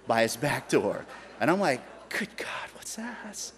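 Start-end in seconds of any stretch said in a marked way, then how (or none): 2.1-3.5 hushed, breathy gasp of awe; awed whisper. Retold or imitated. Spotting the tape machine by the door, awed whisper. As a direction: whisper it in amazement.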